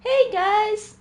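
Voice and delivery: high, chirpy Toronto-style greeting; mock a Toronto local calling out hey guys; imitating a Toronto accent. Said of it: "you gotta say you guys in Toronto".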